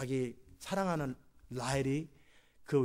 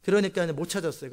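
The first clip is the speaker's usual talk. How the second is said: ordinary talk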